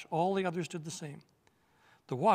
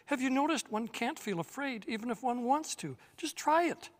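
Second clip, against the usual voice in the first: excited; 9 semitones higher than usual. Acted. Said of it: "said Eustace after he'd tried".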